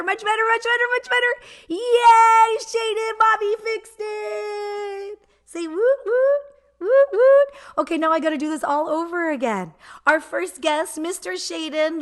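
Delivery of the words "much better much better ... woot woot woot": much higher and louder, bursting with joy; excited cheering. Real, not acted. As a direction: cheer like the problem is finally solved. Sound fixed, cheering with baby.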